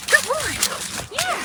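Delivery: very high squeaky praise. Real, not acted. Praising jumping dog.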